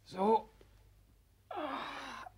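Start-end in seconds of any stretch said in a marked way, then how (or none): 1.5-2.3 pained groan. Acted.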